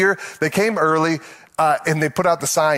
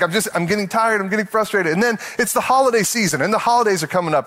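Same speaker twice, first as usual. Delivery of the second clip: normal talking voice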